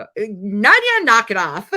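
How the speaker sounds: loud laughing tease; laughing scold. Real, not acted. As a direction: scold her through laughter. Teasing chatter playfully.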